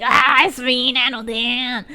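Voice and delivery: loud mock streamer intro; Nano Dan's intro. Nano Dan greeting his stream, loud mock intro. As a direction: announce yourself to chat like a hype host. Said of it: "He's like".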